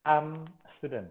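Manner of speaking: "Arm" with a long, slow A. Shown as a demonstration. Teacher demonstrates the long A in "Arm student". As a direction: stretch the A in "Arm".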